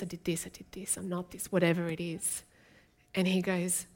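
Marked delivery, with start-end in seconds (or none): 0.0-1.5 whispered self-blame; guilty whisper. Acted. The guilt-ridden talking to themselves, whispered. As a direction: mutter the guilt under your breath. Voicing guilty people, hushed.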